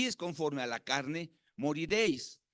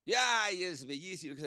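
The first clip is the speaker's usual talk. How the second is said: put-on doting over baby. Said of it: "Y uno lo ve y dice".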